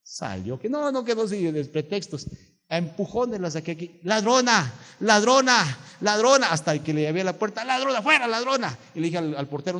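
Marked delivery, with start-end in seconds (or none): 4.0-8.8 loud, high "ladrona" yelled again and again; angry shout. Retold or imitated. Pastor throwing the swindling woman out, shouting angrily. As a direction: drive her out the door yelling thief.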